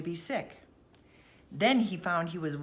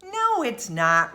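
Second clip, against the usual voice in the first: heated, slow denial; hot retort; 5 semitones higher, 6 dB louder, slower than usual. A narrator's reading. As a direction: shoot back hotly. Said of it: "returned Dan hotly".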